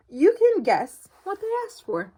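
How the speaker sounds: usual speaking voice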